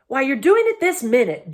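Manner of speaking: dwarf bellowing at the bear. Acted. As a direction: shout the accusation. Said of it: "bellowed Trumpkin".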